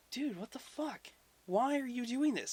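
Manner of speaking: high-pitched incredulous protest; exasperated disbelief. Retold or imitated. Gods protest Loki's reckless bet, high exasperated disbelief. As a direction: plead in a high, baffled voice.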